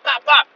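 high, loud popping shots; imitated gunshots. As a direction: punch out each pop like a gunshot.

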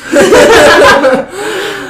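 high burst of laughter; loud laugh. Real, not acted. Scared phone background story.

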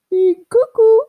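high, loud comic squeal; cuckoo outburst. Acted. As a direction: burst out loudly like a cuckoo call. Clowning about having many personalities.

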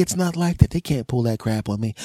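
normal talking voice

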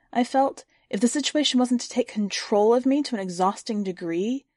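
plain reading voice

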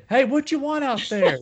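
high-pitched mock yell; imitated bartender yell. Bartender calling to customer at back, high mock yell. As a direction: call out like a busy bartender. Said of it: "the bartender yelled at you".

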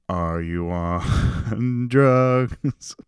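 very slow, a hint of worried mom; mom asking. Comic voicing his mom picking him up from the concert, very slow. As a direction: drag it out like a suspicious mom. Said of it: "Yes, Mom".

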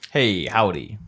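casual American howdy; American accent. Shown as a demonstration. Trying an American accent for the co-host, American accent. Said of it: "read it in an American accent".

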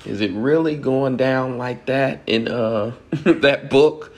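high, slow, half-laughing amazement at the book; shocked reaction. Retold or imitated. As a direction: act out a student's scandalized disbelief while reading. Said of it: "And you go".

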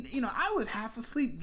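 usual speaking voice

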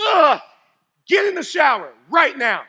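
loud, fed-up order to get in the shower; exasperated shout. Retold or imitated. Camp counselor yelling at boys to shower. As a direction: bark it at the smelly boys. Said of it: "I'm like".

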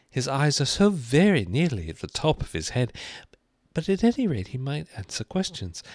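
put-on high-pitched musing girl; Alice to herself. A narrator's reading. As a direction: think aloud in a high character voice.

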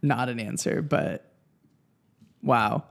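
ordinary talk, nothing marked

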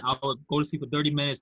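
ordinary talk, nothing marked

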